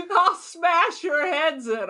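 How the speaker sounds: high, slow mock threat; drawn-out imitation of her yelling at children. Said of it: "what she yelled at the children".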